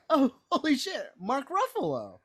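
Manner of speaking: high-pitched, hammed-up shock; mock astonishment. Retelling a viewer's reaction to the Ruffalo cameo, stunned. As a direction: play the stunned moviegoer, voice leaping up.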